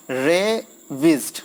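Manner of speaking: slow "रेविस्ड" broken as रे, wished; teacher sounding out "रेविस्ड" in two slow beats; two-part syllable split. Shown as a demonstration. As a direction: stretch the first half of "रेविस्ड", pause, then the second. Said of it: "ये दो भाग में बटेगा".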